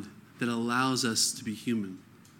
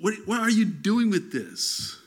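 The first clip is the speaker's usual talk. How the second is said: slow, higher, incredulous voice of God; lightly voiced God asking what you are up to; God questioning; 7 semitones higher, slower than usual. Preacher voicing God's puzzled question to a believer, a lightly voiced incredulous God. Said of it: "There are times that God's like".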